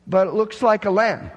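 can't square what he sees; puzzled questioner. Acted.

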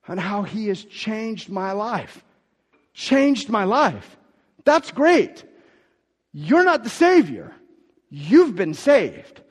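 loud, high, slow preaching; slow emphatic preaching. Acted. Pastor correcting the congregation, slow, loud and high. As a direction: stretch each word out for weight.

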